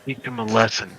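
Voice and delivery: lightly acted captive, soft and halting; subdued captive. Captive confessing to the interrogator, subdued. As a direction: answer quietly and reluctantly under interrogation. Game master plays beaten captive.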